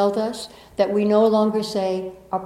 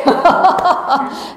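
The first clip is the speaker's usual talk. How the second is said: bright burst of laughter that falls away; 6 semitones higher, 7 dB louder than usual. Real, not acted. Laughing at own story.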